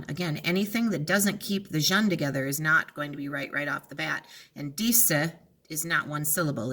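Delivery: ordinary talk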